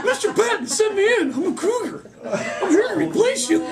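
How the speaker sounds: put-on very high squeaky boy voice, laughing through it; earnest little boy reporting for duty; high squeaky kid reporting in; nervous eager kid. Nervous kid showing his orders.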